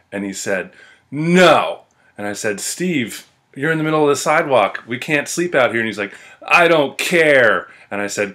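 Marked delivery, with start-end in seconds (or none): 1.1-1.8 loud, raised, drawn-out "no"; whiny mock bellow; stubborn drunk refusal. Drunk friend's stubborn refusal, retold. 2.6-5.8 retold plea, pitched up and insistent; acted-out exasperated appeal; exasperated pleading. Pleading with drunk friend, retold. 6.5-7.7 loud, slow, high mock whine; hammed-up petulant bellow; stubborn drunk protest. Drunk friend's stubborn refusal, retold.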